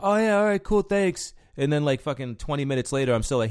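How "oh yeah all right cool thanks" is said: lazy patron. Retold or imitated. Patrons brushing off closing.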